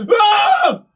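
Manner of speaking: high shrieking mock cry of horror; mock horrified scream. Imitating Gibson fans seeing the bass sanded, shrill mock scream. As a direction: scream like a purist watching the damage. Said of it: "you Gibson guys going".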